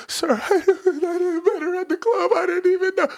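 mock crying plea. Young man sobbing and pleading with the girl's dad. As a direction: beg him through fake sobs. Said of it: "tears streaming down my motherfucking face".

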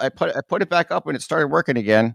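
loud, high voice over alarm; over fire alarm. Real, not acted. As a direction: shout over a blaring alarm. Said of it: "It's extremely loud".

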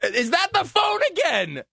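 high, loud and slow; exasperated shout. Real, not acted. Host yells at the ringing phone in mock exasperation.

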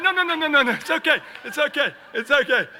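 high, loud, laughing through it; calm him down while cracking up; laughing reassurance. Retold or imitated. Preacher reassuring the fire chief, laughing high and loud. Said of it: "And I'm like".

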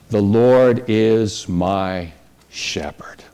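a hushed, awed whisper of the psalm; slow reverent whisper. Acted. Preacher to congregation, treasuring the verse, slow whisper. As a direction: breathe it out slowly, barely voiced.

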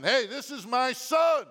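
loud, slow, proud declaration; booming heavenly voice. Retold or imitated. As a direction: announce it like God from heaven.

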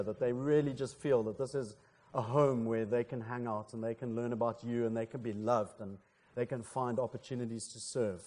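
subdued, earnest address to God; prayer. Real, not acted. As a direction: pray aloud gently on behalf of the congregation. Let's pray.

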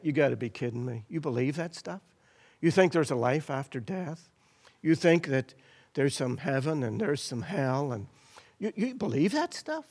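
lightly voiced scoffing doubter; mocking scoffer. The world scoffing at believers, mild mock disbelief. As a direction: sneer at faith, half-hearted. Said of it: "our culture will always say".

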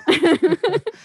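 put-on high wheezing old-timer cackle; old prospector cackle. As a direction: cackle like a gold-crazy miner. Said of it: "That's amazing".

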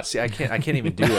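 usual speaking voice

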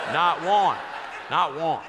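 loud, punched repeat; emphatic. Real, not acted. Teasing his wife about the missing notes, emphatic. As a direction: hammer it home.

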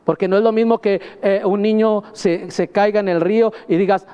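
ordinary delivery

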